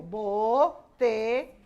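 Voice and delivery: "Botella" very slow, each syllable held. Shown as a demonstration. Teacher writes it on the board, "Botella" slowly sounded out. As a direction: draw out "Botella" in separate syllables.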